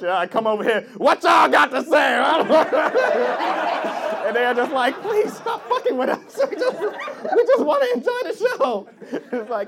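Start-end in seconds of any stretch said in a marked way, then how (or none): 1.0-2.2 high, drawn-out mock bellow; loud hammed-up challenge; confrontational shout. Acts barging up to audience. 5.0-8.9 very high, slow mock whimper; hammed-up timid plea; pleading audience.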